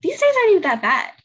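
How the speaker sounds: a lift of relieved optimism; upbeat self-talk. Retold or imitated. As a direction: cheer yourself up, surprised at how fine things are. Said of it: "I was like".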